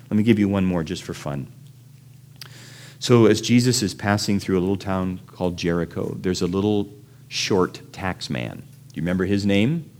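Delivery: normal talking voice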